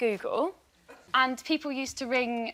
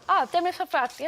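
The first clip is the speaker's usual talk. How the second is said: put-on Welsh accent; 4 semitones higher than usual. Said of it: "obviously Welsh is easy".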